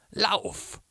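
very high, desperate yell; scream. A narrator's reading. Brother sending his sister away from wolves, screaming. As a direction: scream the command to flee. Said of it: "schrie er noch einmal".

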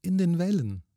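high ghostly reply, only mildly murmured; high, plaintive ghost voice; murmuring ghost woman. A narrator's reading. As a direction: answer as a faraway spirit. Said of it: "murmelte sie".

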